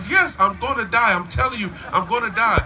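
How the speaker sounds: high, loud, insistent mock wail; imitated crying kid. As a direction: play a melodramatic child insisting to the coach. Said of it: "and he's crying".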